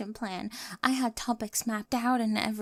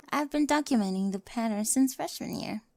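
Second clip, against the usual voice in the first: normal talking voice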